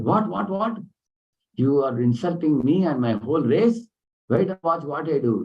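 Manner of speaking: high, rising angry retort with a sharp start; indignant outburst. Retold or imitated. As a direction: play the insulted princess flaring up. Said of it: "And she became angry".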